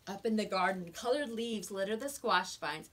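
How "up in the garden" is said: usual speaking voice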